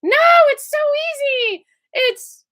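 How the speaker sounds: mock impatient officer. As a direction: squeal it as if it's obvious. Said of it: "She's like".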